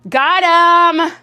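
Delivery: loud, high mocking gotcha shout. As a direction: crow it like a playground taunt. Mocking the commenter's punchline.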